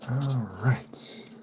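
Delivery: a breathy aside under the breath, no voice; quick whispered all right. Real, not acted. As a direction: whisper it to yourself. Unvoiced muttered aside.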